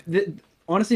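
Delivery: ordinary talk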